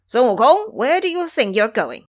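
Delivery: loud, high call across the forest. A narrator's reading. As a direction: call him out from the trees.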